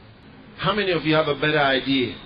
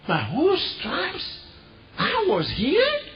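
high excited realization; 12 semitones higher than usual. Retold or imitated. Crippled man's realization acted.

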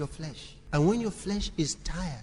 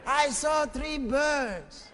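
high, slow, drawn-out preaching; raised-voice preaching; 10 semitones higher, slower than usual. Real, not acted. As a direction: stretch it out to the congregation in a high voice.

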